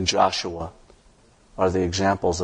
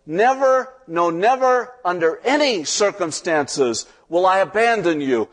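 God shouting the promise; 10 semitones higher than usual. Acted. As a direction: cry out the promise at full voice. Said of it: "God shouts out the promise".

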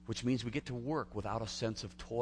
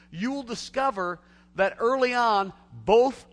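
louder and louder hammered list; slow, climbing pulpit cadence; fiery preaching; 9 semitones higher, 9 dB louder, slower than usual. Real, not acted. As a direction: pound each "There is no" slowly. Preaching at full force.

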